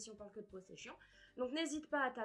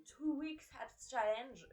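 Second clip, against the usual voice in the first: drawn-out attempt at an English accent; self-conscious English accent on the title; 2 semitones higher, 3 dB louder, much slower than usual. Real, not acted. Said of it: "j'ai vraiment mon accent anglais".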